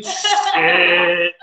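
a mock dismayed mutter of Shit; voicing a friend's silent dread lightly; imitating inner thought. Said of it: "Charles sitting there thinking".

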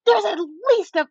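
high-pitched, loud, excited mimicry; imitating a loud, thrilled stranger. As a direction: blurt it out high for all to hear. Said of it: "and she's loud".

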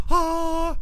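a high, drawn-out awestruck "Ah."; awed gasp. Retold or imitated. Seeing the two strangers lit up, a high awed sigh. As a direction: gasp like you've seen angels.

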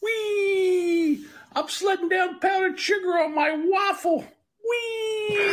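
very high squealing character voice; squeaky cartoon voice. Video replayed, same high squeal.

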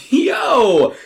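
loud high yelp through laughter; laughing shout. Acted. Ronnie falling off his chair, a laughing high shout. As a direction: burst out laughing. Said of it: "Ronnie falls out of his chair laughing".

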